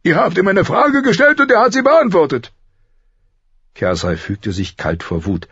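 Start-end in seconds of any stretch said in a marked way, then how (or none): 0.0-2.5 loud, high rebuke; raised, exasperated royal voice; angry king. A narrator's reading. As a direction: shut her down forcefully. King rebukes his queen.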